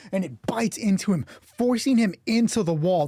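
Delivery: ordinary delivery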